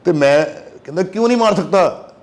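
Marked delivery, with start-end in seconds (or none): 1.0-2.1 imitating an angry father, pitched higher; mock-angry demand thrown back, voice jumping up; father-in-law snapping back in mild mock anger; angry retort. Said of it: "ऐसे बोला ना गुस्से से".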